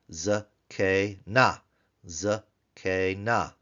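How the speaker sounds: "zakena" slowly as za, kay, na; three separate syllables. Shown as a demonstration. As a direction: leave gaps between the syllables of "zakena". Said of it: "Three-syllable word".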